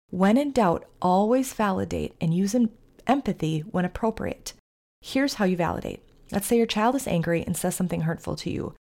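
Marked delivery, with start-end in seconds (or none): none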